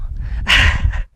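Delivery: brief breathy chuckle; quick, voiceless little laugh; short laugh. Real, not acted. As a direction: let out a short laugh at slipping. Laughs after slipping on ground.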